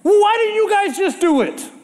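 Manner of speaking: lightly voiced Jesus scolding his friends; exasperated Jesus. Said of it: "that's what I hear Jesus saying".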